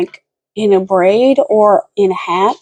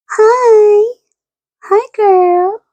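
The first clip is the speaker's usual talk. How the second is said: much higher, bright hello to a dog; pet greeting; 11 semitones higher, 3 dB louder, much slower than usual. Real, not acted.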